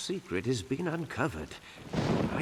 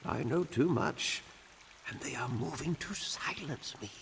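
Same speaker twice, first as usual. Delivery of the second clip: ordinary talk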